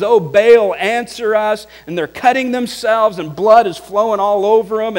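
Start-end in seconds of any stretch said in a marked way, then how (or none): 0.0-1.7 loud, desperate cry, higher; crying out loudly. Retold or imitated. Prophets of Baal crying to their god, loud. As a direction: call out to a silent god. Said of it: "crying out with loud voices".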